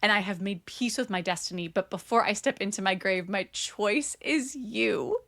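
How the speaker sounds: low, solemn reading that slows to the end; dramatic romantic vow. Acted. Hero choosing her over his crown, low dramatic vow. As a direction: build to the last words, drawing them out. Reads climactic vow from novel.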